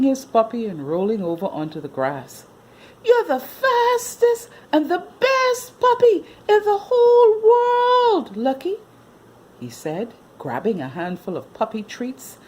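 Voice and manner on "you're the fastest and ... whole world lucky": put-on praising boy.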